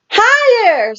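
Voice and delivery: put-on very high toddler squeal; high little-boy squeal. A narrator's reading. George on the swing.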